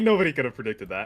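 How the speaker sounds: ordinary talk, nothing marked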